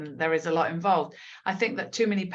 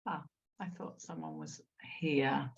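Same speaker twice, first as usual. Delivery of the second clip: unvoiced hush so as not to interrupt; whisper; 6 semitones lower, 11 dB quieter than usual. Real, not acted. Host slipping into a breakout room, whispering to herself. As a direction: breathe it softly, no voice at all.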